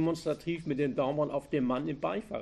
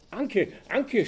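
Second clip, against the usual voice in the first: stunned stammer, higher; 8 semitones higher, 5 dB louder than usual. A narrator's reading. Farmer recognizing Anke at gunpoint, stunned stammer. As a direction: blurt her name in shock. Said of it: "stotterte er nur".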